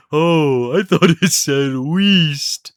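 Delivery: slow, drawn-out cartoon reply; cartoon quote. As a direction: imitate a dim cartoon sidekick, stretching the last word.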